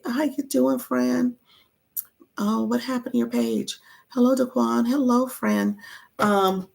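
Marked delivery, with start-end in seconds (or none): none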